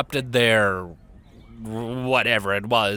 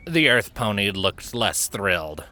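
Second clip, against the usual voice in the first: plain reading voice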